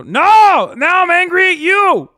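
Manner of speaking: high, loud shouted whine; hammed-up furious shout; mock angry yell. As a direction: yell it like a tantrum. Acted angry reply, loud.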